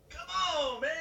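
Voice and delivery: high, calling out "come on, man" like a carefree passerby; exuberant street guy. Retold or imitated. A joyful sidewalk stroller calling to a stopped car, high and loud. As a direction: shout it out loose and happy.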